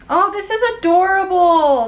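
high, loud delighted gush; excited gush. Real, not acted. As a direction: squeal over a cute shirt. Gushing over a gift.

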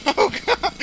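high laughing yelp of Oh god; echoing the sound bite. Retold or imitated. Gamer copying the ragdoll's cry, high laughing mock yelp. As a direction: parrot the game's cry, laughing. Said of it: "I love the little sound bites".

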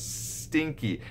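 playful stinky. Shown as a demonstration. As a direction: play it silly for a kid. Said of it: "bringing in humor".

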